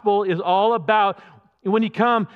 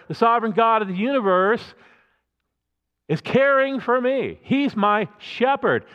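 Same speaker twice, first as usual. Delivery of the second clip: usual speaking voice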